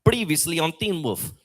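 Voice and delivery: put-on South African TV recap voice; South African accent, announcing a series recap. Shown as a demonstration. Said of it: "I'm gonna try it again".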